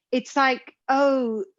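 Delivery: ordinary talk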